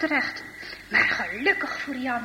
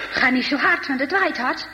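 ordinary delivery, nothing marked

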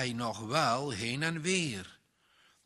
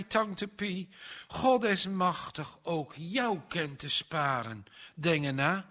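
ordinary delivery

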